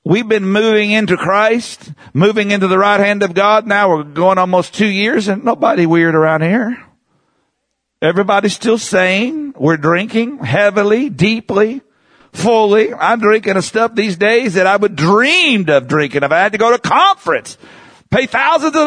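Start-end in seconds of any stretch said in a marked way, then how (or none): none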